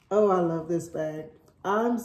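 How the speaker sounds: normal talking voice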